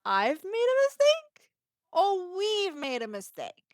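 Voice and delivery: incredulous pitch leap on mistake; indignant disbelief. Retold or imitated. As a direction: throw the blame back in disbelief. Indignant retort to blaming nurse.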